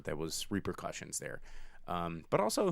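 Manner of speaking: ordinary talk, nothing marked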